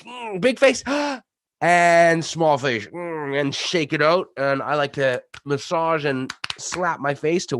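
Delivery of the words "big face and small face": slow, loud, high gasp between the words; slow loud gasp drill. Shown as a demonstration. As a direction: stretch the big face gasp out. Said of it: "we go back and forth".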